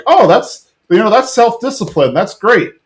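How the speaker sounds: lightly hammed-up praise from the dad; imitating Hank. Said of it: "he's like".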